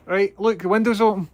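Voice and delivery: high, loud coaxing call; coaxing a wild bird. Real, not acted. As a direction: coax the bird out, calling loudly.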